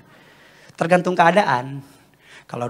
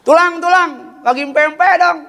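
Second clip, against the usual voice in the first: put-on high, loud child-like begging; pleading little relative; 12 semitones higher, 6 dB louder than usual. Nephew or niece begging uncle for pempek, high pleading call. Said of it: "keponakan saya minta".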